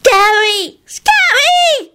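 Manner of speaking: put-on very high little-child squeal of fright; terrified scream. A narrator's reading. Young William screaming at the wolf, terrified squeal. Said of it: "he screamed and pointed wildly".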